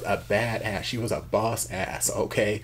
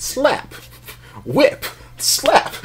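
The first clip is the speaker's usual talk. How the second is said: shrill, much higher and louder sound effects; loud mock slap sounds; 7 dB louder, much slower than usual. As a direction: act out each slap loudly. Imitating the beating sounds.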